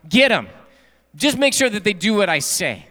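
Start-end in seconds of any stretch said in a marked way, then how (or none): 0.1-0.5 mock harsh enforcer. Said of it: "think about the commandment as".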